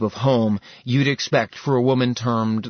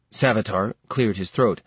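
plain reading voice

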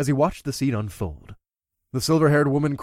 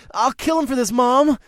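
angry vow; 11 semitones higher, 4 dB louder than usual. A narrator's reading. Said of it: "the boy promised".

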